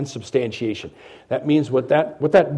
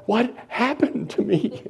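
bewildered man; 9 semitones higher, 3 dB quieter than usual. Retold or imitated. Voicing a bewildered crying man.

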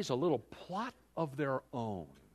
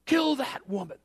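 high, loud mock command; king ordering her death, high and loud; mock king's order; 12 semitones higher, 7 dB louder than usual. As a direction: bark it as the angry king. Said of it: "And he could say".

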